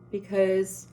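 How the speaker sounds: ordinary talk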